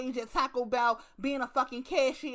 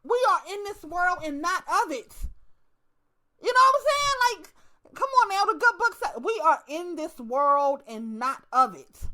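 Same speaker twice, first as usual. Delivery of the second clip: rising, louder churchy delivery; mock preaching; 4 semitones higher, 5 dB louder than usual. Comedian preaching the good book to the crowd, louder. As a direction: preach it like Sunday.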